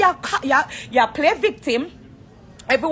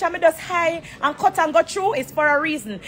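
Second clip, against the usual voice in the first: ordinary talk, nothing marked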